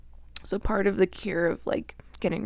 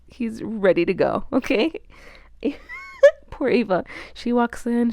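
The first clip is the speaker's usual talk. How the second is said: ordinary talk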